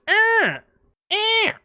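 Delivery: high, flat alarm honk; imitated alarm beeps. Alarm going off every few seconds, high mock honks. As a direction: mimic the blaring alarm. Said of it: "every, like, five seconds".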